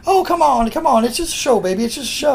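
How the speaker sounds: high-pitched mock coaxing; Honky Tonk Man. Wrestler brushing off the manager's complaints, mock high-pitched coaxing. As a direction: plead in a high voice, easing off toward the end. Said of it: "Honky Tonk would say".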